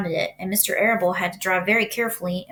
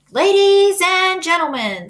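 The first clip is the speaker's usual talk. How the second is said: slow, much higher and louder announcing; grand announcer; 9 semitones higher, 7 dB louder, slower than usual. A narrator's reading. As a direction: proclaim it like a ringmaster. Loudspeaker presents the pig.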